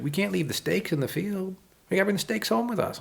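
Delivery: put-on very high little-boy voice; high-pitched, insistent retold child; young child. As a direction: imitate an earnest kid pleading. Young son's worried plea.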